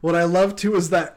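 ordinary talk

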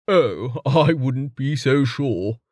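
slow, teasing drawl; lightly voiced older man, amused; Jameis, slow and sly. A narrator's reading.

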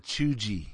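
"chuggy" said as choo-jee; soft j in "chuggy", like choo-jee; imitated mispronunciation. Host telling co-host how they pronounced it, imitated mispronunciation. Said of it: "you pronounced it chuggy".

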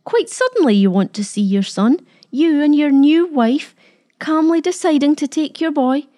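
mock him with a bitter edge; bitter mockery. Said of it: "she mocked".

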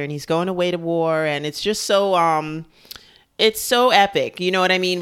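normal talking voice

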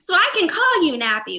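high, loud mocking voice; mock clueless person. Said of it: "mocking clueless person".

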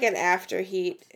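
ordinary talk, nothing marked

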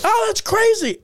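high, retold burst of amazement; amazed reaction. Comic retelling his reply to the campaign worker, amazed.

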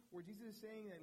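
ordinary talk

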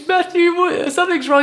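high, loud mock-delirious cry for help; retold yelling. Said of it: "I'm yelling at him".

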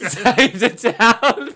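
a hammed-up hero's rallying cry; mock heroic cry. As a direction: draw it out like a hammy hero.

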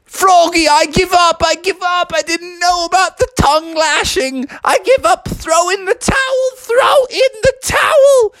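very high, frantic pleading; hammed-up desperate giving up; panicked surrender. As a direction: beg to quit in a high, wailing voice. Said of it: "And Kevin was like".

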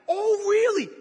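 high, loud incredulous retort; indignant. Retold or imitated. Bristling at a preacher's words, indignant. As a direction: explode in offended disbelief.

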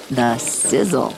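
ordinary talk, nothing marked

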